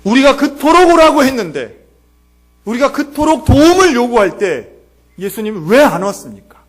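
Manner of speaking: loud, high-pitched complaint; hurt reproach. Acted. Sulking sister complains to Jesus for not coming, hurt reproach. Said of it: "예수님께 삐진 거예요".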